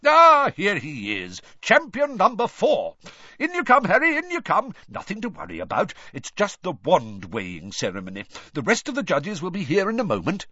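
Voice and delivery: loud, booming cheerful host; jovial hearty official. A narrator's reading. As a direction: welcome him like a jolly showman. Bagman greets Harry heartily.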